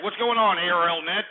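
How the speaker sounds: ordinary delivery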